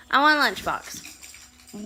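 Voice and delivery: put-on high, slow kid voice; a little boy asking; lightly voiced small child; child's voice. Son voiced asking for lunchbox.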